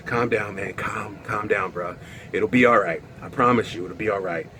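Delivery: low, soft calming voice; reassuring friend. Retold or imitated. Friend calming the panicking guy, soft and low. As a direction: settle him down gently.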